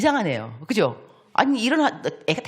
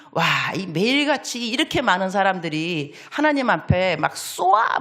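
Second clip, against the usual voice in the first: ordinary delivery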